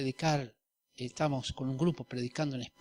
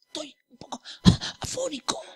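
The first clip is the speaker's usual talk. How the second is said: high, weak put-on hoarse voice; mock croaky excuse to dodge preaching; fake sick voice; 12 semitones higher than usual. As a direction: play the feeble excuse, pitched way up. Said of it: "Yo dije así".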